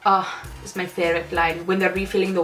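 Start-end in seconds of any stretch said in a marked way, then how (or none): none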